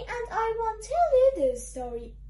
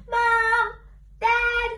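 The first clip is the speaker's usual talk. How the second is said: loud, raised call; calling for parents; 2 semitones higher, 5 dB louder than usual. Acted. Book character telling on the reader to parents, calling out. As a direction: call out to someone in another room. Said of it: "Now I'm calling your parents".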